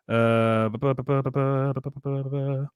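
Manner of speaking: rapid "buh-buh-buh" on one note; idle filler noise. Real, not acted. Host stalling before the next topic, idle buh-buh-buh filler. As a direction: babble a string of buh sounds while thinking. Filling time between topics.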